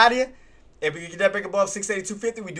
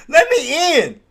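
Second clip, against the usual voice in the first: high, loud frustrated plea; shouted demand; 11 semitones higher, 10 dB louder, much slower than usual. Real, not acted. Trader locked out of his trade, high frustrated yell. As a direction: yell to be let in.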